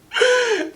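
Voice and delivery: very high drawn-out fake sob; mock wail. Woman playing up missing her homegirl, mock wail. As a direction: squeal out a pretend cry. End of mock-tearful call.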